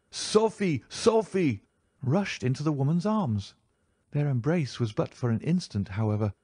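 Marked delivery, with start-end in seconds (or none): 0.1-1.6 high desperate cry of her name, twice; screaming name. A narrator's reading. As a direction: call out to her, high and anguished. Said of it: "screaming out".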